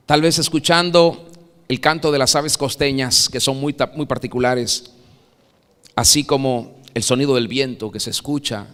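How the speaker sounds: usual speaking voice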